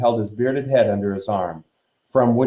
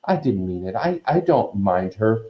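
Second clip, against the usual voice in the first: usual speaking voice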